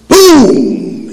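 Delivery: loud, high mock gunshot; gunshot boom. Pete showing off his shooting, loud boom. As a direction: bellow the shot out. Shooting ducks, acted shout.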